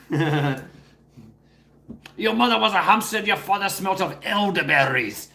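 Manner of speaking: lightly hammed-up French taunt; mock French accent. Quoting the French taunter to the chat, mild mock French accent. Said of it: "such a outrageous accent".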